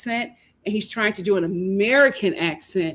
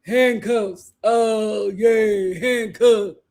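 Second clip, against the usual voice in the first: mocking his in-the-throat speech; put-on throat voice; throaty mimicry; 3 dB louder, much slower than usual. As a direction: say it from the throat, imitating him. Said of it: "he speaks in his throat".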